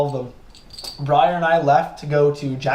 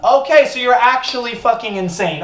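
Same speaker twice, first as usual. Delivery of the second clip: exasperated; 8 semitones higher, 6 dB louder than usual. Retold or imitated. Exasperated reply to the neighbor.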